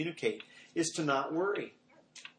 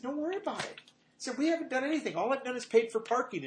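reassuring dad; 10 semitones higher than usual. Retold or imitated. Father comforting daughter in story.

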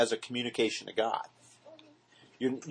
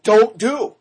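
higher, louder sharp command; emphatic warning; 8 semitones higher, 12 dB louder than usual. Retold or imitated. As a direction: bark out the warning. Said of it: "exactly what Jesus said".

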